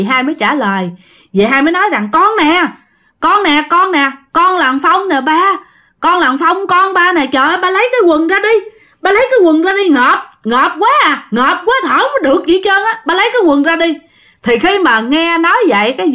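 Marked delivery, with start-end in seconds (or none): 2.1-14.0 high-pitched, insistent pleading; pleading son's ghost. Acted. Possessing son begs father to take the trousers off, high plea. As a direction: beg to be let out for air.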